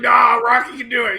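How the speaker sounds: high, hammed-up shouted objection; mock movie yelling, pitched high; Cousin Paulie shouting. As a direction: shout back over the ringside panic. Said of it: "And he's like".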